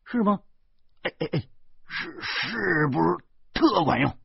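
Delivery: high-pitched, stammering surprise; amazed. Acted. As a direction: react in eager astonishment, tripping over repeats. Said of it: "小贩惊奇地说". (惊奇地尖着嗓子追问)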